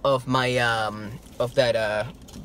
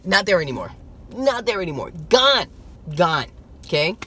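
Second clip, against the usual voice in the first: ordinary talk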